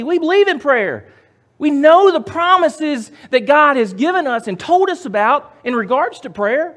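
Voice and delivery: very high, loud pulpit fervour; full-force preaching. Real, not acted. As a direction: cry it out to the congregation.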